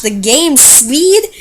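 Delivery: drawn-out slow speech; slow motion. Pretend. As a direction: talk slowly to match the laggy game. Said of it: "I'm gonna try and talk in slow motion so I can match".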